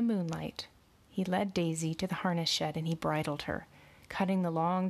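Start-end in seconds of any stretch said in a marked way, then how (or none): none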